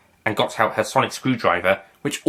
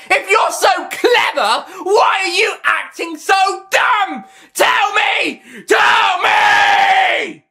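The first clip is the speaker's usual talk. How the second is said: screaming demand; 5 dB louder, much slower than usual. Acted. Master demands answers.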